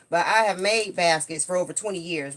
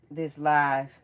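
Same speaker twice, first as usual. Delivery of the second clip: usual speaking voice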